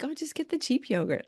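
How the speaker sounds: high-pitched nagging little voice; little inner voice. Acted. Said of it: "it's that little voice".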